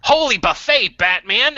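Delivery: excited sidekick voice, high and loud; hammed-up Batman sidekick cry; campy Robin exclamation. Batman-style Robin exclamation parody.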